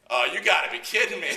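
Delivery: loud high laughing disbelief. Retold or imitated. Trooper catching the same guys, loud laughing disbelief.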